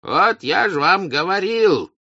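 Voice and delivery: high, loud, drawn-out rebuke; self-important told-you-so; reasserting authority. A narrator's reading. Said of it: "вступая в свои права".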